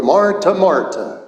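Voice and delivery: gently chiding in a mild Irish brogue; lightly imitating an Irish priest's brogue. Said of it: "he would say in his Irish brogue".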